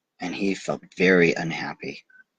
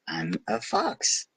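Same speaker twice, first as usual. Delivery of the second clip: fox reply; 5 dB quieter, slower than usual. A narrator's reading. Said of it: "said the fox".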